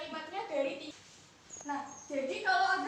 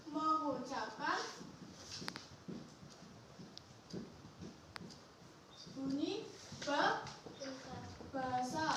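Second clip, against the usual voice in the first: slow, word by word with long pauses; dictation; 5 dB quieter, much slower than usual. Real, not acted. Teacher dictating notes to pupils, slow dictation. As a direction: dictate it softly, leaving gaps for writing.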